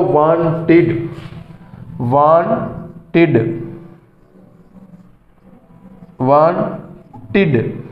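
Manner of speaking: वांटेड in two beats, ending "tid". Shown as a demonstration. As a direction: sound out the "id" at the end of वांटेड slowly. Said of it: "यहाँ इड की आवाज़ आएगी". (वांटेड में इड की अलग आवाज़)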